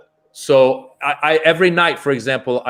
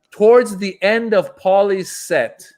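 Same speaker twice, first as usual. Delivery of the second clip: ordinary delivery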